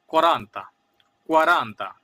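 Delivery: কোরান্তা said as Italian quaranta, loud and clear. Shown as a demonstration. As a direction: demonstrate কোরান্তা firmly, Italian kwaranta. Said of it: "যেগুলোর উচ্চারণই আলাদা". (জোর দিয়ে ইতালীয় কোরান্তা)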